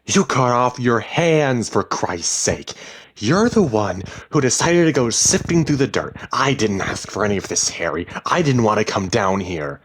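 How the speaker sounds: heated shouting match; furious accusation. A narrator's reading. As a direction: hurl the blame in anger. Wife yells accusations in quarrel.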